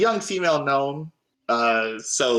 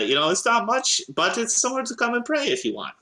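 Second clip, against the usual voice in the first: high, modest gnome voice; friendly gnome cleric; 10 semitones higher, faster than usual. Acted. Gnome cleric offers her chapel for prayer, high and friendly. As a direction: offer it kindly in a high character voice.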